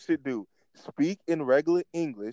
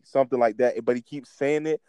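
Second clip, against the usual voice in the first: ordinary delivery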